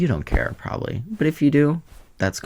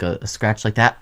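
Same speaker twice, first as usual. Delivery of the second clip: normal talking voice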